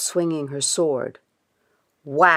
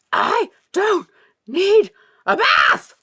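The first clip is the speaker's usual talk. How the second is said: high, loud, slow protest; gasped protest; 9 dB louder than usual. Acted. Dirty Ulf protesting to her mother from the tub, loud and high. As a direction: splutter it out of the water. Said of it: "gasped Dirty Ulf".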